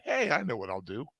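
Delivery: ordinary talk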